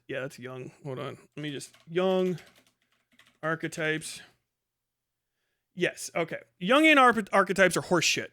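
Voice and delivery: normal talking voice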